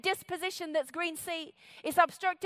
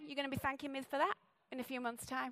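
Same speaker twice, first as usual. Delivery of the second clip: ordinary talk, nothing marked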